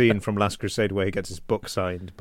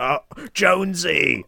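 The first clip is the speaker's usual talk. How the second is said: mock Cockney Jonesy; 9 semitones higher, 3 dB louder, slower than usual. Ray Winston impression.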